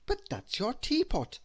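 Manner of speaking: can't believe it's a teapot; bewildered. Acted. Christmas staring at the wizard's teapot, bewildered. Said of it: "I said in bewilderment".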